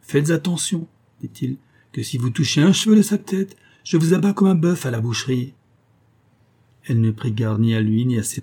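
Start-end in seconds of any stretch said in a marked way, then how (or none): 0.0-5.5 higher, firm warning; stern threat. A narrator's reading. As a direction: warn her with menace. Blacksmith threatens the freed mother. (menace sévère)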